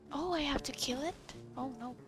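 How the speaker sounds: usual speaking voice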